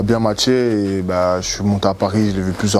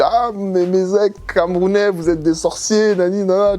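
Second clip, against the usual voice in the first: higher mock voice imitating the friend who distrusted him; mocking Marwa; 9 semitones higher than usual. Recalling Marwa's first jab at him, high mocking imitation. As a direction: play her teasing him as a sorcerer's ex, pitched high. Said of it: "elle m'a dit".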